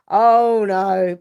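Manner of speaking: loud, alarmed "Oh, no". Retold or imitated. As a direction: blurt it out in alarm. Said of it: "And I was like".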